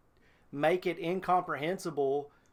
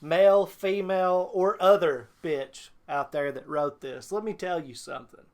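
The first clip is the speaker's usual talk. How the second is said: wrestling promo; 2 semitones higher, 4 dB louder than usual. Real, not acted. Calling out the fanfic author like a wrestler, loud. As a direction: cut a furious promo on the author. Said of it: "You gonna cut a promo?".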